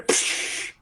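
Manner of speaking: mimicked steam release; a drawn-out hiss of escaping steam. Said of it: "in the steam, you hear".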